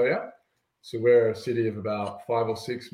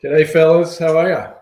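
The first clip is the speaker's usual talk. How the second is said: excited greeting; 6 semitones higher, 10 dB louder than usual. Real, not acted. Live greeting calling out.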